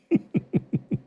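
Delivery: short high-pitched giggle; high laugh. Real, not acted. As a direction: let a quick high laugh slip out. Laughs at a chat joke.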